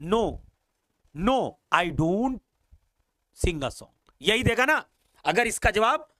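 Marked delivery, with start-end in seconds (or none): 0.0-3.9 drawn-out negative sentence; slow teacher demo answer; slow model answer. Shown as a demonstration. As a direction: lay each word down slowly. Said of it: "तो क्या जवाब देगा बेटा ये?".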